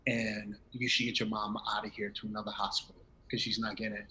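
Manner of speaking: retold hushed whisper, barely voiced; secret whisper. Doctor quietly warns son in hospital hallway, whispered. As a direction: whisper it like a doctor breaking the rules.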